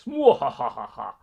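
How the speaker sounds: slow, loud villain cackle; evil mwahaha laugh; mad scientist laugh. Pretend. Reading a villainous chat message.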